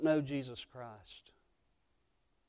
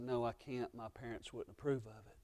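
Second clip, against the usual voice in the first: soft, lowered, polite no; gentle refusal; 2 semitones lower, 11 dB quieter than usual. Acted. Boy Jesus quietly refusing his friends' mischief. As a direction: turn them down quietly. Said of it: "And Jesus said".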